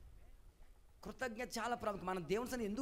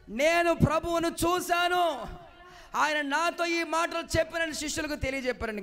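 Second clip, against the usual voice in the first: excited announcement; 5 semitones higher, 10 dB louder than usual. Acted. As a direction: proclaim it like wonderful news. Mary announces it to the disciples. (మరియ శిష్యులకు సంతోషంగా గట్టిగా ప్రకటిస్తూ)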